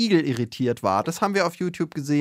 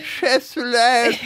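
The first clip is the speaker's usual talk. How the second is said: high, slow cooing; sweet-talk voice; 8 semitones higher, 4 dB louder, much slower than usual. Pretend. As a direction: coo it like a lovestruck sweetheart. Sweet-talk pet name.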